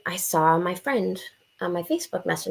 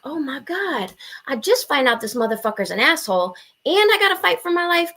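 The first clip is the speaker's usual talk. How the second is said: high-pitched, dramatized dismay; acting out mounting disbelief at rotten luck; exasperated panic; 7 semitones higher than usual. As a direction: wail it in comic exasperation. Panicked inner reaction, retold.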